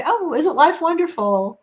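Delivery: high, singsong contentment; blissful self-mimicry. Speaker mimicking herself sitting down after interruption, blissfully carefree. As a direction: sigh it out high and airily carefree.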